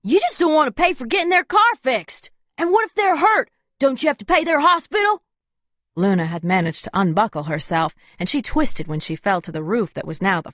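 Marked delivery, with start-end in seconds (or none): 0.0-5.2 much higher, youthful indignant man; younger male voice. A narrator's reading. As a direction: argue like a young guy sure he's right. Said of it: "a much younger male voice".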